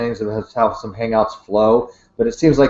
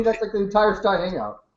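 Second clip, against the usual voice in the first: ordinary talk, nothing marked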